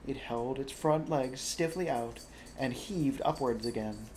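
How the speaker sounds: ordinary delivery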